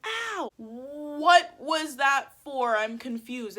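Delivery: high, slow, incredulous yelp; acted-out indignant ouch; retold hurt protest. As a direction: draw it out, baffled and wounded. Retold protest after the shove.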